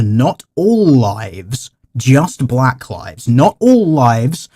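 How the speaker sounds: slow sarcastic emphasis. Acted. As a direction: drag every word out, mockingly slow. Mocking the clip's slogan.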